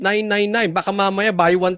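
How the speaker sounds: normal talking voice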